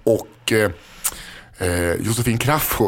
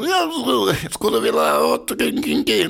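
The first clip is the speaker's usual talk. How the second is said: much higher, slow put-on Putin voice in Swedish; high drawn-out mocking Putin impression; 11 semitones higher, slower than usual. Host answers cohost with Putin in Swedish, high slow put-on voice. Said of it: "Han, han".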